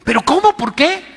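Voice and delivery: indignant disbelief. Retold or imitated. Driver protesting in retold story.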